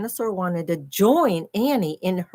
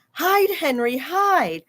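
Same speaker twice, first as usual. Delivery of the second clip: urgent warning; 5 semitones higher, 3 dB louder, slower than usual. Acted. Annie warning the dinosaur Henry.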